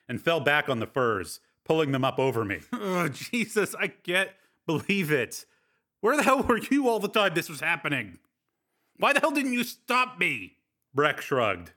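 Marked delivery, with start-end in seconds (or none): 2.7-10.6 high, rising exasperated protest; horrified at what he did last night; mortified disbelief. Acted.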